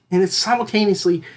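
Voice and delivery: ordinary talk, nothing marked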